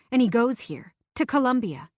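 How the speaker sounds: plain reading voice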